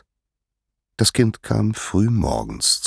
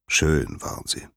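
usual speaking voice

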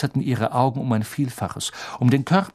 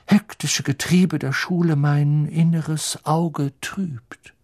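Professor Trelawney; 5 semitones higher, 4 dB louder than usual. Acted. Narrator voices Professor Trelawney. (geheimnisvolle, gedehnte Wahrsagerinnenstimme)

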